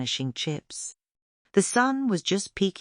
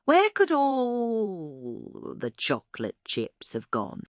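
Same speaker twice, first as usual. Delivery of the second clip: put-on slow, high child voice, wondering aloud; a girl puzzling over the missing chips; wondering little girl; 5 semitones higher, slower than usual. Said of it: "Tia said".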